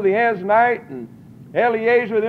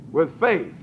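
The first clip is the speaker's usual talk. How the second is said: normal talking voice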